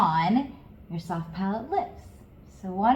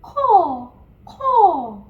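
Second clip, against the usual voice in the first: high ko; 8 semitones higher, 9 dB louder than usual. Shown as a demonstration. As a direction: say ko up in the yawn space. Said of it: "try to say ko in it".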